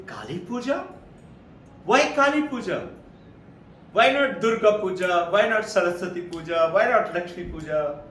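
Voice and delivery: a shopkeeper who can't believe her ears, firing questions; very surprised. Retold or imitated. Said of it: "The Gujarati lady is very surprised".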